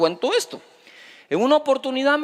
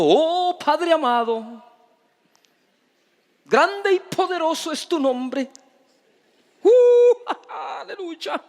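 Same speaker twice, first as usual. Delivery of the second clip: mock pompous prayer voice; 7 semitones higher than usual. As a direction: parody a showy, pious prayer with a fake holy laugh. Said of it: "de hablar de forma extraña".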